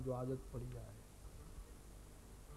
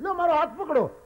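loud, much higher offer of help; calling out; 11 semitones higher, 6 dB louder, faster than usual. Retold or imitated. Second rescuer shouting to the merchant, loud high call. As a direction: offer your hand with a shout. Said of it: "કારણ કે પોતે આપવાની ટેવ જ નથી".

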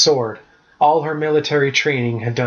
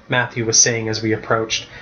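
ordinary delivery

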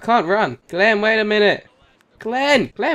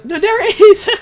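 excited shout; 14 semitones higher, 6 dB louder than usual. Real, not acted. Spotting flying car, excited.

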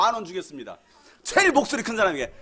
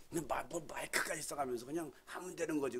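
whisper; 7 semitones lower, 19 dB quieter than usual. Retold or imitated. Neighbour's complaint retold.

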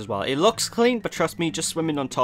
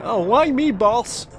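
whiny crewman; 7 semitones higher, 4 dB louder than usual. Pretend. Crewman Larry whines to boss.

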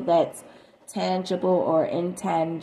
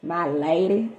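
lightly voiced suitor saying "my lady"; lightly imitated lover's address; imitated sweet-talker. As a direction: put on a touch of a wooing man's talk. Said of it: "person could talk like that".

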